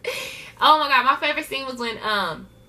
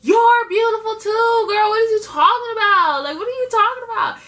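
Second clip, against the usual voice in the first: Bianca reassuring; 9 semitones higher, 4 dB louder than usual. Retold or imitated. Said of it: "And Bianca was like".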